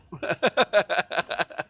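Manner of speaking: high, loud laughter tailing off; long laugh at your own impression. Real, not acted. Player cracks up at the roar, laughing hard.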